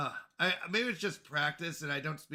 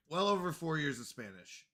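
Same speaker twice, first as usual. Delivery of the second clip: ordinary delivery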